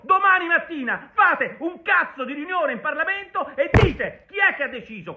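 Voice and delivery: full-throated political rant; angry shout. Real, not acted. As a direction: yell at the opposition MPs in outrage.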